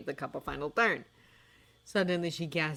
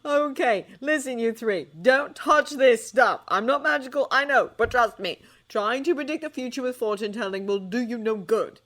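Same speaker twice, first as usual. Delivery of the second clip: put-on louder, firm older man laying down the law; stern warning; 7 semitones higher, 8 dB louder than usual. A narrator's reading. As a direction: warn them off hard. John warns the three.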